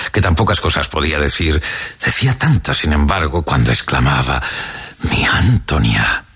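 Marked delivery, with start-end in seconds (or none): 5.1-6.2 slow, lower, tender "Mi Antonia"; tender exclamation. A narrator's reading. Poor Mr. Simerda fondly calling his daughter, slow and tender. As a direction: say it as if those two words held everything. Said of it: "pensaba en el tono con el que".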